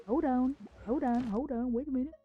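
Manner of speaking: usual speaking voice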